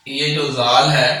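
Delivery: ordinary talk, nothing marked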